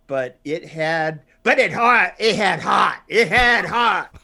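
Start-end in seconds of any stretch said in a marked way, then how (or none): 1.4-4.2 loud, high mock announcer shout; Jeremy Brown impression. Doing a Jeremy Brown impression for his cohost, shouted loud and high. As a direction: bellow it like a gushing film reviewer. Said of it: "you doing a Jeremy Brown impression".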